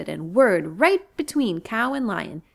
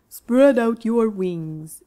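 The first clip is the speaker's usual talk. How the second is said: very slow and louder cow; 5 dB louder, much slower than usual. Acted. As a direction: coax the bird gently. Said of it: "said Cow".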